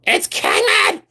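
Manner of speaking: high, cracking parody shriek; mock scream. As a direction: cut in like a screaming reseller. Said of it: "Do I have to scream about it?".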